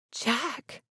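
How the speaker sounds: soft breathy gasp of his name; startled hushed breath. A narrator's reading. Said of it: "she breathed".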